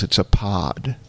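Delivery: condescending, forced patience; patient, talking down to a child. Retold or imitated. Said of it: "She said it in that tone of condescending, forced patience".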